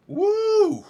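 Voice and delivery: high, loud whoop of delight; excited whoop. Real, not acted. Fan whooping after a great metal track, wild cheer. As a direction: let out a thrilled cheer.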